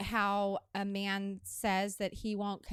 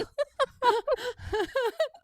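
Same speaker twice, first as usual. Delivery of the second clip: high laughter; 6 dB louder than usual. Real, not acted. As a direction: burst of high delighted laughter. Host laughs at chewing co-host.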